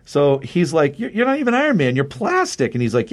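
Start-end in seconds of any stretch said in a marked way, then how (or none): 1.0-2.7 high, scornful mock voice, a touch put-on; imitating a high, scornful jeer; mocking squeak. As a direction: sneer it up high at the fake hero. Rocket mocking fake Iron Man.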